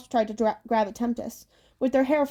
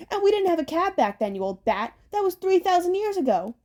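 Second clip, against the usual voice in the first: bickering hag; 8 semitones higher than usual. Acted. Hags bicker in the cab.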